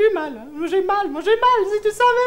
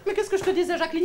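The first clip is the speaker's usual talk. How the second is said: ordinary delivery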